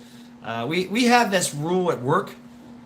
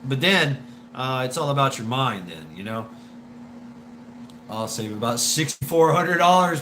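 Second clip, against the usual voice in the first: usual speaking voice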